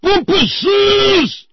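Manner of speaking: full-throated, high exasperated holler; loud yell. Acted. Channeling a movie dad cursing the neighbors, loud yell. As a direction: bellow it out, drawing out the last syllable. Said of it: "I just yell out loud".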